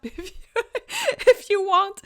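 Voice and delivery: giggling high through the words; laughing. Real, not acted. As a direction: laugh it out at the double meaning. Laughing at her own joke.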